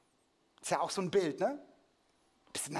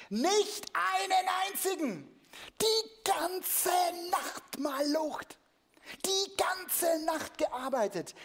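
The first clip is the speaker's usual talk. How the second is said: much higher, slow, whining put-on voice; frustrated fishermen; 14 semitones higher, 4 dB louder, much slower than usual. Disciples complaining back to Jesus, high slow whine. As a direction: whine it like tired, empty-handed fishermen. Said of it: "riefen sie zurück".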